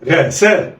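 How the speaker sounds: "غا سا" joined in one quick go; snatch "غا سا" with no pause; snatched together. Shown as a demonstration. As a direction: blend "غا سا" like one word. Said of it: "يلا، اخطف".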